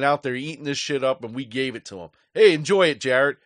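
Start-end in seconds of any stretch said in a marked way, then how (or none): 2.3-3.4 loud, mocking "enjoy it"; sarcastic taunt. Real, not acted. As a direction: spit out fake congratulations, much louder. Sarcastic jab at a hated fan.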